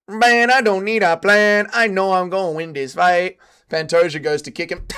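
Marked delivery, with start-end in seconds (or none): 0.1-3.4 higher, louder mock-accented swagger; Joshua Van. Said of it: "when they were like, Hey, Joshua Van".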